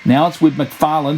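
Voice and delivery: ordinary talk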